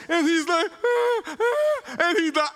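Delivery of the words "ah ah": put-on high-pitched toddler panic squeals; imitated freak-out. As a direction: mimic a child freaking out, very high. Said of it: "he's freaking out like this".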